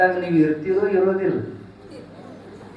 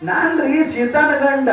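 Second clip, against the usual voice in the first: high eager disciple; 9 semitones higher, 4 dB louder than usual. Acted. Rama answering guru.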